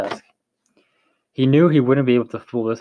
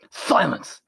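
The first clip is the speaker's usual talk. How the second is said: a sharp, higher call for silence, only mildly voiced; shouted; 5 semitones higher than usual. A narrator's reading. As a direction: cut them off with a command. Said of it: "the loud man shouted".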